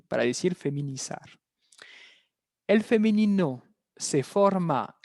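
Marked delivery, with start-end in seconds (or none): none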